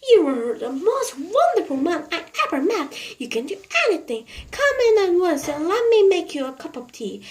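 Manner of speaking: lightly voiced, delighted cry; cried out. A narrator's reading. Mrs Silver gushes over Mr Hoppy at the door, a light delighted cry. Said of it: "cried out".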